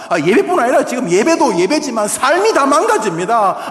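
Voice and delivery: loud, high impassioned sermon; fervent preaching. Acted. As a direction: preach it with full fire. Preaching at full force.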